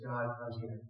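quiet prayer. Retold or imitated. Voicing a believer's humble prayer, quiet prayer. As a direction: pray it hushed and surrendered.

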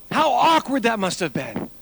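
loud, high preaching at full force. Acted. Pastor retelling Peter to the congregation, loud preaching. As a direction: preach it out to the whole room.

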